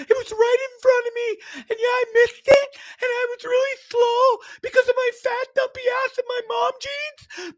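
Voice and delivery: shrill high crying shout, whining over the ball; mimicking Karen. Vlogger mimicking Karen demanding the ball from the dad, high crying shout. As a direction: wail like a tearful entitled fan, way up high. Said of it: "fucking mimicking Karen".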